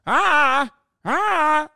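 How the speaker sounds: high, loud dopey "ah, ah"; bobblehead impression. Mocking the candidate's stammer, high and loud. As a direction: play the stammering bobblehead again. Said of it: "That's what he's saying every time".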